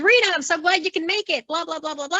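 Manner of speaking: high, sing-song imitation of a glad welcome; mock gushing greeting. Commissioner greeting his new referee on arrival, mock gushing. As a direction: gush it high, then trail into dismissive blahs.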